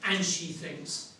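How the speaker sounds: ordinary delivery, nothing marked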